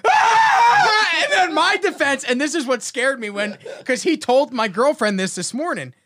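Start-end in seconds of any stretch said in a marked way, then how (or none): 0.1-1.2 very high laughing shriek; mock child's scream, much higher; imitated kid scream. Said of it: "my kid starts screaming".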